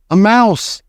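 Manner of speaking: high cry of alarm; shouting. A narrator's reading. Kitchen staff spotting the falling mouse, shouting. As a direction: raise the alarm, pitch up. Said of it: "they were shouting".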